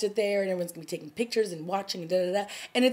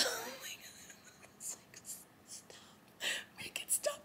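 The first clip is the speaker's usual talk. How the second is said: breathy whispered plea; overwhelmed whisper; 19 dB quieter, much slower than usual. Real, not acted. Overwhelmed by Rowan's words, a whispered plea. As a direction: whisper it, begging it to end.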